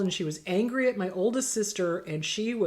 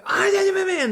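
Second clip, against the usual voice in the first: high, loud mock ranting; garbled, pitched-up burst of mock yelling; overtopping yell; 10 semitones higher, 7 dB louder than usual. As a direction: imitate someone shouting over the other person. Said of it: "if they overtop you".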